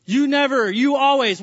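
a touch of blame in "You never" and "You always"; clipped mock accusations to a spouse; mock accusing. Shown as a demonstration. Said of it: "These are words of evil".

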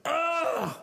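high mock groan of disgust. Disgust at Adam eating.

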